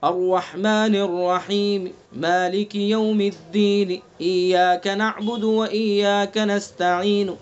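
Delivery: slow tajweed recitation of the verses; Quranic recitation. Shown as a demonstration. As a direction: recite slowly with faint final vowels. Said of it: "جیسے".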